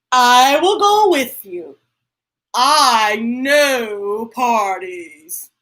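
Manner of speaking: slow, drawn-out elephant voice; a lightly deepened confident elephant; Gerald voice. Acted. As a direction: stretch I know parties slowly. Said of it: "Gerald answers".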